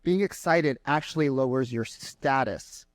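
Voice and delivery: ordinary talk, nothing marked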